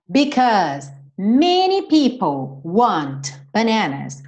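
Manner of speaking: Because many people want bananas said slowly; slow model sentence. Shown as a demonstration. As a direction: read it slowly, word by word. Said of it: "Or because a lot of people".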